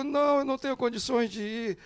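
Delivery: high, pleading mock fright; frightened refusal. Fan telling bodyguard he can't go, high pleading. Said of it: "Eu digo".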